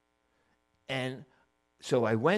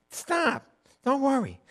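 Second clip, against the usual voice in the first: much higher-pitched mock reassurance; dismissive tempter; 10 semitones higher, 4 dB louder than usual. Serpent telling Eve she won't die, high dismissive reassurance. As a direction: wave off the worry in a high voice. Serpent brushes off God's warning.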